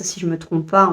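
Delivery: ordinary talk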